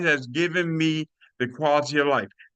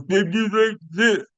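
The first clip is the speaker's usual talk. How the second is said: showing how he once sounded, forced and slow; strained weak voice demo; 6 semitones higher, 4 dB louder than usual. Shown as a demonstration. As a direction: push the words out, slow and strained. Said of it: "that's exactly how I sound".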